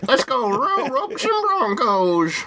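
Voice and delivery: high, slow hammed-up cowboy yell; American cowboy accent. Co-host mocks how Americans sound, high slow cowboy accent. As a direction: rodeo cheer in a fake Texan accent. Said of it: "Is that how Americans sound".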